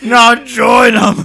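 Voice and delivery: high, loud, very slow shout; dramatic shout. Acted. Mentor crying out at betrayal, loud slow shout. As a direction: cry it out in anguish. Star Wars parody quote.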